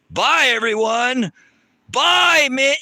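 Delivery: loud, high, drawn-out mock farewell; sarcastic goodbye. Real, not acted. Streamer mockingly says bye to the heckler, loud and high. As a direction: wave them off with a sneer.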